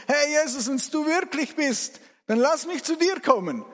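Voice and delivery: high, eager shout across the water; Petrus. Acted. Disciple calls to Jesus from the boat, shouting eagerly. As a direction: call out boldly from afar. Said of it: "hörst du den Petrus".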